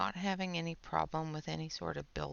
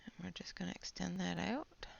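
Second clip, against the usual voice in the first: whispering; 4 dB quieter than usual. Real, not acted. Whispers while coloring on stream.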